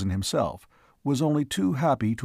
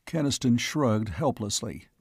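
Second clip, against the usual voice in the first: ordinary delivery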